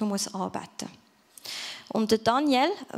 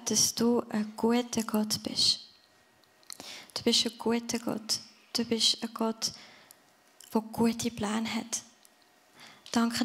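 heartfelt prayer. Real, not acted. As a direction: pray it gently to the Father. Closing prayer.

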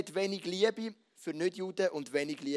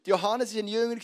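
normal talking voice

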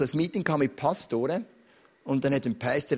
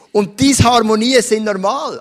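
loud preaching; 5 semitones higher, 10 dB louder than usual. Real, not acted. Preacher's emphatic point.

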